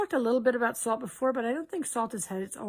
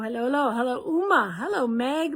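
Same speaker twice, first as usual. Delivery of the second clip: ordinary talk, nothing marked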